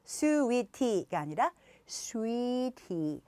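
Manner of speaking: 스위티 then 스위티 slowly in two beats; 스위티 first wrong, then in two beats; 스위티 said slowly twice; slow syllable contrast. Shown as a demonstration. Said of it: "음절만 바꿔도".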